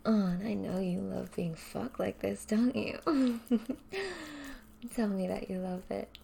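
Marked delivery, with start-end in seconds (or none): 3.0-4.9 a short laugh pitched up; teasing laugh. Acted. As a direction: laugh at how she sounds. Teasing partner.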